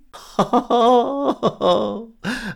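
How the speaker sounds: high, sly fox laugh. Acted. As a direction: laugh slyly at the victim. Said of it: "Répond en riant Renard". (rire narquois et aigu)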